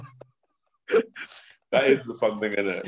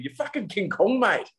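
put-on Australian accent, pitched up for a jeering sledge; mock Australian sledge; 12 semitones higher than usual. Brian Smith taunting Max on the field, in a mock Australian accent. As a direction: jeer him in a hammed-up Australian accent. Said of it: "Brian Smith did that exact thing".

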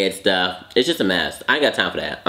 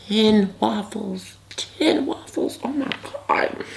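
very high, thin and slowed-down voice; overwhelmed squeak; 11 semitones higher, 4 dB quieter than usual. Real, not acted. Eater counting ten waffles left to finish, overwhelmed squeak. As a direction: trail up into a soft, high squeak of disbelief.

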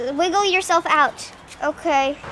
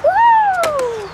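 a high, loud woo; excited whoop; 13 semitones higher, 7 dB louder than usual. Acted. Ken enjoying the ride, high loud woo. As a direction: cheer mid ride.

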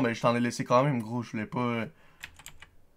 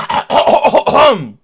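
loud raspy hacking; harsh throat clearing; 13 semitones higher, 18 dB louder than usual. Real, not acted. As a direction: hack hard to clear the throat. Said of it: "suis en train de mourir".

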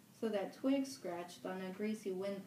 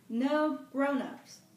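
slow, higher, much louder cry of delight; gleeful; 7 semitones higher, 11 dB louder, much slower than usual. Acted. Upside-down Ralph crows to the fat boy, gleeful slow cry. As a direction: savour it, triumphant.

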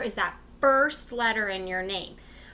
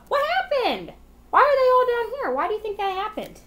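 much higher, fast puzzled questions; mock surprise; 12 semitones higher, 6 dB louder than usual. Teacher asking kids in mock surprise why letters fell. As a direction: ham up the confusion for the kids.

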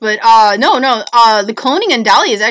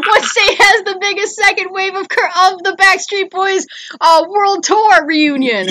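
laughing joke; 7 semitones higher than usual. Pretend. Joking along with a silly question.

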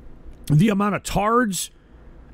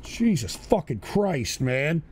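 normal talking voice

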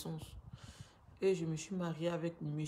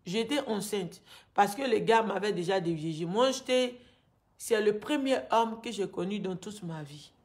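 raised, agitated pleading; upset; 2 semitones higher, 7 dB louder than usual. Real, not acted. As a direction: let the anger pour out louder. Angry account of forced marriage.

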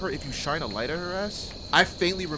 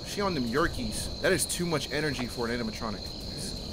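usual speaking voice

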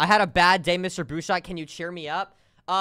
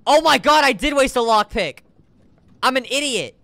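loud self-scolding; frustrated shout; 5 semitones higher, 7 dB louder than usual. Real, not acted. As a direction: yell at yourself. Exclaiming at own mistake.

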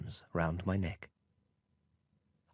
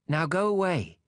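put-on higher, brighter child voice; little prince voice; 8 semitones higher, 4 dB louder than usual. A narrator's reading. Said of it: "the little prince said".

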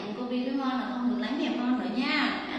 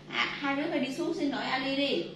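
ordinary talk

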